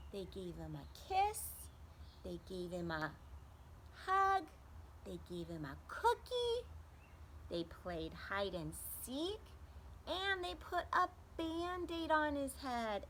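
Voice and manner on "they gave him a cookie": ordinary delivery, nothing marked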